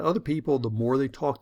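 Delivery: normal talking voice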